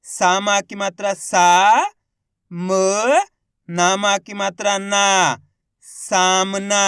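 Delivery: long सा, म, long ना, then सामना; slow spelling into a careful सामना; letter by letter. Shown as a demonstration. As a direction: sound out each part, then blend. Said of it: "एक अक्षर का उच्चारण करके".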